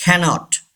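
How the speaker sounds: CANNOT as KA-not, one N; single N. Shown as a demonstration. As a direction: glide through "cannot" with one short N. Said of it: "एक एन यहाँ पे pronounce नहीं". (cannot में एक N silent, "कैनॉट")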